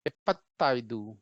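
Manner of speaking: एपत्ताइदू said slowly, each sound clear; Kannada pronunciation demo. Shown as a demonstration. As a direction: model एपत्ताइदू slowly for learners. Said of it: "कैसे पढ़ेंगे?".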